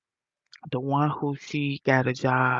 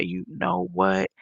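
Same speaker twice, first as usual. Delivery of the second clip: imitated giving up; 7 semitones lower, slower than usual. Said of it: "she wanted me to say".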